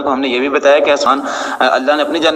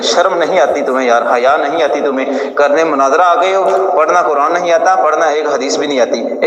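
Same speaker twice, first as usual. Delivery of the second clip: normal talking voice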